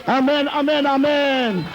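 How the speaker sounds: ringing, repeated blessings with long-held cries; rally the church into praise and applause; fervent preaching. Real, not acted. Preaches blessings at full force.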